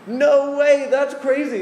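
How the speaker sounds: high, loud disbelief; incredulous outburst. Acted. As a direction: exclaim it like you can't believe it. Reacts in disbelief to the rebuke.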